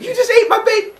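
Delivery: high, loud laughing screech; mock outrage at the husband; outraged mother. Rhea screeching at Cronus over the eaten baby.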